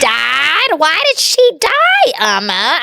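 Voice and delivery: high, drawn-out mock child distress; upset child imitation. Imitating a girl watching a sad movie, shocked and high. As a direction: wail it like a shocked little kid.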